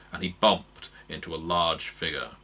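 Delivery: ordinary delivery, nothing marked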